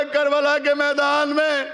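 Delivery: lamenting preacher's cry. Acted. Preacher opens the Karbala tragedy to mourners, keening high. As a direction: wail the words out to the mourners.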